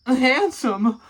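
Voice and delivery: high, loud dramatic handsome; a loud, high, mock-dramatic reveal; hammed-up handsome. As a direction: swoon over the word.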